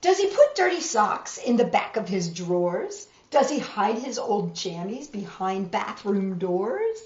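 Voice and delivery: storybook reading voice; reading to children. A narrator's reading. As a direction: read it like a bedtime picture book. Reads picture book to children.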